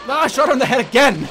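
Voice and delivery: loud, high outburst of protest; exasperated shout. Real, not acted. Player protesting another failed hit, real exasperated shouting. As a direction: yell in exasperation at the game.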